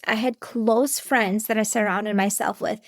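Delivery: ordinary talk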